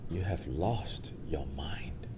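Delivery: slow, soft whisper; secret whisper. Acted. Milarepa reveals to the boy what he truly lost, whispered slowly. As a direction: breathe it like a secret.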